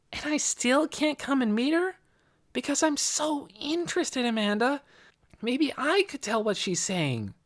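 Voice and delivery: put-on very high, eager child's voice; Nicky, pleading girl. A narrator's reading. Girl asking to meet the prophet, high and pleading. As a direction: press the request in a high young voice.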